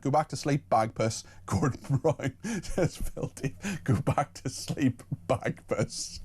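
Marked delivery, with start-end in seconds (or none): 1.5-6.2 real giggles, words slowed and softening; laughing while reading. Real, not acted. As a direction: try to read it out through helpless laughter. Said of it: "I'm still laughing at that".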